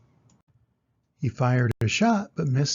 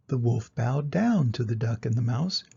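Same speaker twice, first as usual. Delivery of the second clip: ordinary delivery, nothing marked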